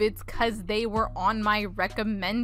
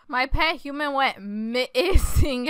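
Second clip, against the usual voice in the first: usual pitch, words stretched out slowly; slow repeating. Acted. Player repeats the words while typing, slow repeating. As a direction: draw out MISSING at a slow pace.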